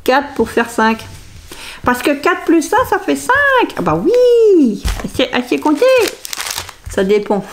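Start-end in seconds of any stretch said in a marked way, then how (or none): 1.8-5.9 high, swooping sing-song cheer; mock self-congratulation. Se félicite pour rire de savoir compter. (fausse fierté, voix aiguë et chantante)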